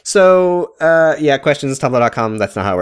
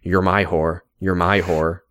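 lightly voiced, lower, looping the line like a glitching clip; a hint of Ben's voice repeated on a loop; mock Ben on repeat; imitating Ben; 5 semitones lower, 4 dB quieter, slower than usual. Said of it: "a looping vine of Ben going".

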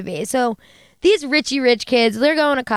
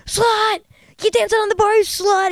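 high-pitched imitation of an angry yell across a bar; imitated outraged shout, high voice; mock screaming; 10 semitones higher than usual. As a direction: retell his jealous scream, pitched way up without full volume. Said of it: "from across the bar screaming".

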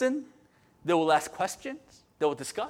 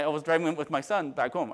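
ordinary talk

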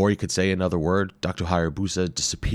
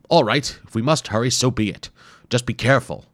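higher, louder fussy warning; grumpy professor giving in; 6 semitones higher, 6 dB louder than usual. Acted. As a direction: give in grudgingly, then warn. Wimple gives in, warns.

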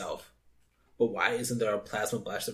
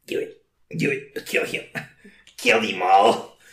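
evil villain voice; 9 dB louder, slower than usual. Pretend. Acting villain urging kills.

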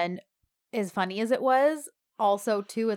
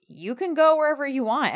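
raised, higher mock defiance; retold quarrel retort, pitched up; defiant retort; 6 semitones higher, 7 dB louder than usual. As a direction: act out a louder, defiant comeback. Acted quarrel, her defiant retort.

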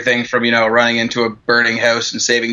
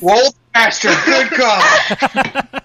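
mock shocked; 11 semitones higher, much slower than usual. Teasing old man.

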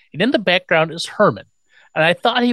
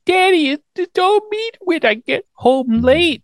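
put-on very high babyish complaint; imitated baby voice, pitched way up; mock baby talk. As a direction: whine in a put-on little-girl voice. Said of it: "she's baby talking".

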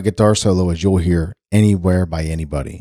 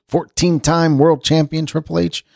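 ordinary talk, nothing marked

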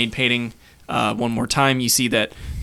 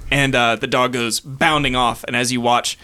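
normal talking voice

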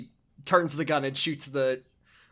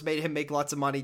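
ordinary talk, nothing marked

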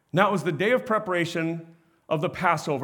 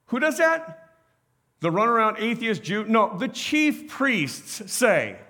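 ordinary delivery

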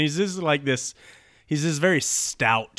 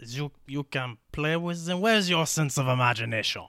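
put-on French-accented retort; hammed-up French accent; 3 semitones higher than usual. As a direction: answer as the French sailor. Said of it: "the French guy is like".

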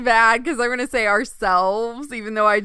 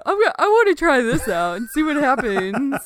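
lightly voiced eager experimenter, pitched up; a hint of a DNA splicer guy, higher; 4 semitones higher than usual. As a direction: play the reckless scientist a little. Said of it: "your D N A splicer guy impression".

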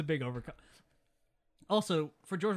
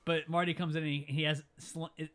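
ordinary delivery

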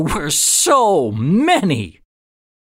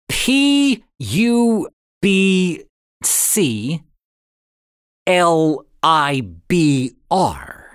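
slow, letter by letter, with pauses between; spelled out slowly; much slower than usual. A narrator's reading. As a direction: read each surviving letter slowly, as if deciphering it. Reading broken inscription letter by letter.

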